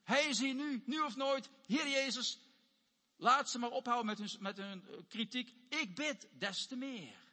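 excited voicing of the blind man's prayer; urgent plea. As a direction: cry out to Jesus, now or never. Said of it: "Hij zegt".

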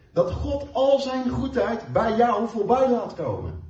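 usual speaking voice

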